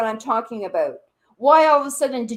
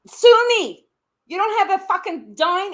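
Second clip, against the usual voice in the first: higher, louder, a real furious shout of defiance; 7 semitones higher, 5 dB louder than usual. Real, not acted. Arguing with the lash seller on stream, a furious shout. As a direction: spit it out as a dare, full volume. Live argument, daring her to sue.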